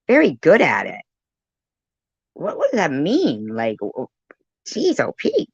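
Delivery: usual speaking voice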